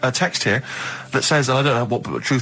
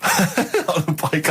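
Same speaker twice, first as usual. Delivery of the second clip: slow, amused echo through a laugh; laughing repeat; 2 semitones higher, much slower than usual. Real, not acted. Echoing Karl's objection back to him, laughing slowly.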